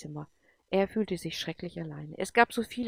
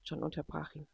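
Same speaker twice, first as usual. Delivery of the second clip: ordinary delivery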